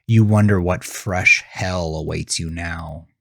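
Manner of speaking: usual speaking voice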